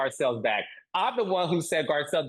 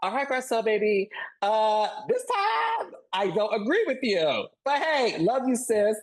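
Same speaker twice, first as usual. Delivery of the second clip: higher, affectionate sisterly sass; sassy but loving; 7 semitones higher than usual. Retold or imitated. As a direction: tease her gently while disagreeing. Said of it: "I'm also able to say".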